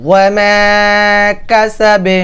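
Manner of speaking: slow chanted verse with long মালুহু; recitation demo. Shown as a demonstration. Teacher demonstrating heavy গয়ন in recitation. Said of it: "মোটা করে উচ্চারণ করবেন".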